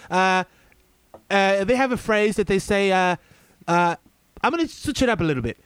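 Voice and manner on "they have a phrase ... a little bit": usual speaking voice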